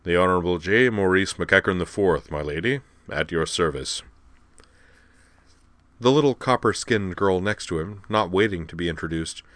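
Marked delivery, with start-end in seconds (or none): 0.0-4.0 rumbling basso. A narrator's reading. Giant Moose introducing himself to my lady, rumbling basso. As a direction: rumble it formally in a big bass. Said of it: "rumbled in a tremendous basso voice".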